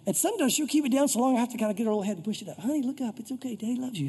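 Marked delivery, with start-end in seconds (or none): 2.6-4.1 soft daddy-to-pet voice; tender coaxing. Retold or imitated. Owner lifting dog's little head, tenderly coaxing. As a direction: coax a dog gently, dropping softer. Coaxing his dog to look up.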